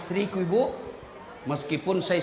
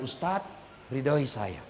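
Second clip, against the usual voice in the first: soft plea; 6 semitones lower, 6 dB quieter, slower than usual. Retold or imitated. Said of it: "pasti dia bilang".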